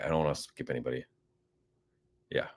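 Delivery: usual speaking voice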